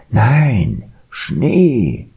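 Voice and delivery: swooning, drawn-out high delight; rapturous polar bear voice; enraptured polar bear. A narrator's reading. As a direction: gush dreamily, letting each word soar and fall. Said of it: "er schwärmte verzückt".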